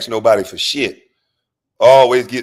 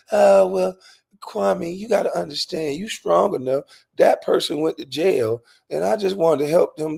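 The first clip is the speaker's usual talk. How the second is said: mom's voice pitched up, soft and pleading; voicing his mom; 6 semitones higher, 4 dB quieter than usual. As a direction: play his mother gently begging him to understand. Mother pleads in retold argument.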